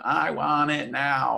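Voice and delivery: put-on high, slow childlike whine; whiny child. Inner child pleading with the grown-up, high whine. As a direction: play the demanding little kid inside you. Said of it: "the child going".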